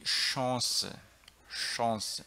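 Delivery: "chance" as "shon-se", starting with sh; French-style Chance. Shown as a demonstration. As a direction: keep the French sh and nasal vowel in "Chance". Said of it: "Vem do francês". ("chance" à francesa, com ch chiado)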